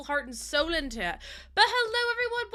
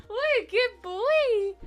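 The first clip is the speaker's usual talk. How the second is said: praising dog; 6 semitones higher, 4 dB louder, slower than usual. Real, not acted. Praising dog after howling.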